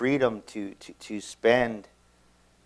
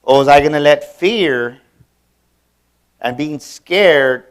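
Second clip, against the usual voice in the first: preaching climax; 4 semitones higher, 14 dB louder than usual. Real, not acted. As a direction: drive it home to the congregation. Sermon climax, decision.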